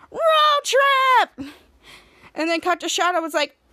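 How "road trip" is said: high, loud, excited shout; group cheer. Retold or imitated. Team in unison at the plan, excited shout. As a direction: whoop it like a whole team. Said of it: "in unison said".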